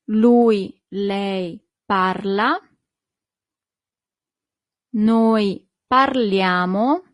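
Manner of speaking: slow, deliberate teacher pronunciation, pausing between persons; slow model conjugation. Shown as a demonstration. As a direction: say each form slowly for learners to copy. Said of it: "Parlare is a very regular verb".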